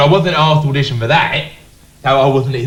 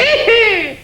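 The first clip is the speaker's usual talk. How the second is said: high squeaky cackle. Retold or imitated. Mimicking the little man beside Jabba with a high cackle. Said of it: "He'd go".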